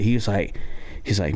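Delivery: usual speaking voice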